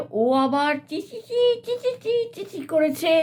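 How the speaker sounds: high squeaky chi chi; squeaking. Acted. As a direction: squeak like a small animal. Hare squeaks for help.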